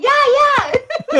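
high, excited retold yes; eager agreement. Doctor Demento happily handing over the sign, eager. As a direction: imitate someone agreeing keenly, voice leaping up.

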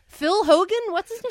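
high-pitched, baffled challenge; loud, rising bafflement; incredulous question. Real, not acted. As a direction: shoot the name up high in disbelief. Baffled challenge over the host's name.

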